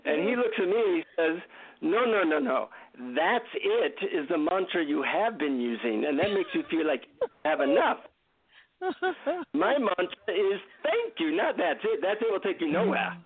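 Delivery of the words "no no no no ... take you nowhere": animated, corrective Indian guru voice; deep Indian accent. Retold or imitated. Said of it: "deep Indian accent".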